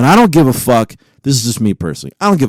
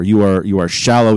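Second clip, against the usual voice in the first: usual speaking voice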